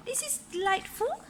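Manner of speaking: high, bright lady's voice; Missus Armitage voice. A narrator's reading. Parent reading aloud, voicing Missus Armitage, high and prim. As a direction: lift the voice up for the lady. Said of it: "said Missus Armitage".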